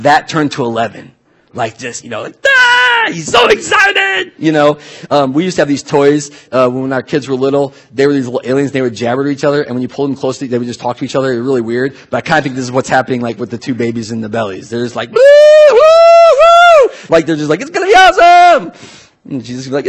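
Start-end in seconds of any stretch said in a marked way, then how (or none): 2.5-4.3 very high, loud excited shout; excited squeal. Pretend. Unborn John leaping for joy, a very high excited shout. 15.1-16.9 very high, loud squealing woos; cheering babies. Pretend. Babies in the womb cheering, very high loud woos. 17.6-18.7 very high, loud cartoon voice; giddy baby voice. The babies in the bellies hyping each other, very high and loud.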